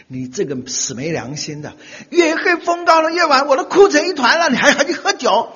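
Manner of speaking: high mock-tearful scolding wife; hammed-up scolding wife, high and loud; imitating nagging wife. Wife scolding husband years later.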